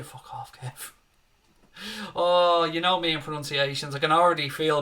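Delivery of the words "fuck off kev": hushed brush-off to a teasing viewer; muttered retort. Real, not acted. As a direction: say it under your breath, barely voiced.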